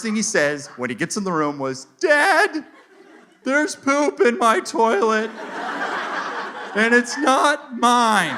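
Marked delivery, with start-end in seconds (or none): 2.0-8.4 high, drawn-out imitation toddler voice; little kid. Kid tells Dad about poop in the toilet, high mock-child voice. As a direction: play an alarmed small child, pitched way up and slow. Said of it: "then that kid walked into the room".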